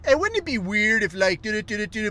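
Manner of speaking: hammed-up Canadian accent teasing a question. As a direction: imitate an annoying Canadian news guy. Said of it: "that aggravating Canadian accent".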